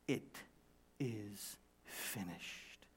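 very slow, low and hushed; solemn finality. Acted. Preacher stressing what Jesus said, slow solemn hush. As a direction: draw out each word, softly final.

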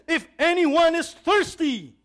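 high, drawn-out, full-voiced call; loud voice. Preacher voicing Jesus calling out to the feast crowd, loud. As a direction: call out slowly and loudly to a whole crowd. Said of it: "but in a loud voice".

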